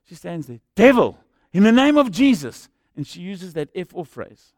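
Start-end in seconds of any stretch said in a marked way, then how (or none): 0.8-2.7 loud, raised-pitch prayer command; commanding rebuke. Retold or imitated. Elderly lady confronting the demonic presence, commanding shout. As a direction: cry it out as if casting out a demon.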